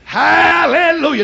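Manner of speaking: high, slow, drawn-out hallelujah; exultant praise. Real, not acted. Preacher praises God before congregation, exultant praise. Praise shouted after climax.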